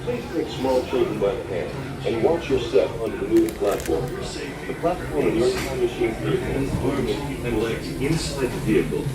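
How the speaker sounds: ordinary talk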